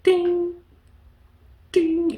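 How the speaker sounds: high, ringing ding; high-pitched bell imitation; imitated little ding. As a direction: chime it like a tiny bell. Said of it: "there's a little ding sound".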